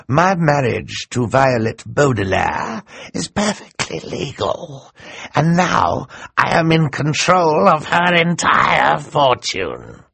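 triumphant theatrical villain revealing his plan; scheming Count Olaf. Acted. Count Olaf addresses the audience.

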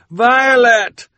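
high, loud shout over rushing water; shouting over the roar. A narrator's reading. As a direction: yell to be heard over a torrent. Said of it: "shouted over the roar of the water".

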